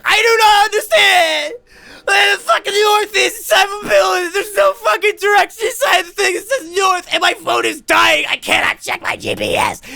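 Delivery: high, shrieked, tearful-sounding meltdown; hysterical wailing. Retold or imitated. Lost caller with dying phone begs Schindler, hysterical. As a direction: wail and plead, hammed up to breaking point.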